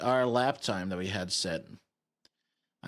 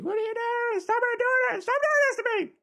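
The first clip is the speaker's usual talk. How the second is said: squeaky whiny viewer, a bit louder; high-pitched chat voice; 3 dB louder than usual. Retold or imitated. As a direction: squeal it like an impatient viewer begging. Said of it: "everyone's gonna be like".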